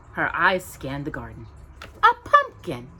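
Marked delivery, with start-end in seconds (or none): 2.0-2.9 high, loud, triumphant little pronouncement; delighted godmother. Acted. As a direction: reveal it like a treat. Godmother announcing.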